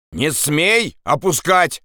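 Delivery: slow, high-pitched order; commanding. Acted. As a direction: order him from above, drawn out. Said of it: "приказал ему голос". (протяжный приказ)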